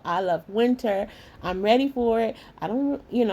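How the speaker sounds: usual speaking voice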